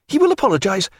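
high, urgent assurance; crying out. A narrator's reading. Diana promising Stephen an apology, urgent. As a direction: insist in a high, urgent woman's voice. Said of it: "crying out".

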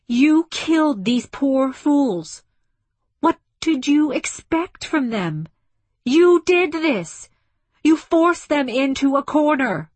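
high, loud, impassioned shouting; angry accusation. A narrator's reading. Woman blaming the ship for the dead, shouting angrily. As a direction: hammer the blame home.

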